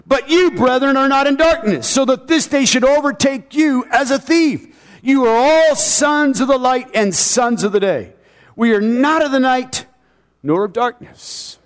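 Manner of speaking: high, declamatory Bible reading; preached scripture reading. Real, not acted. Preacher reading verse four to believers, proclaiming it high.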